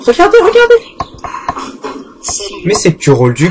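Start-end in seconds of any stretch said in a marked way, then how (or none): 0.1-1.0 squeaky, much higher "regardez"; silly high voice. Acted. Showing viewers how the bus wobbles, in a squeaky cartoon voice. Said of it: "je vais vous montrer".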